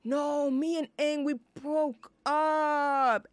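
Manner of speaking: a hint of Katara drawling. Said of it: "and she's like".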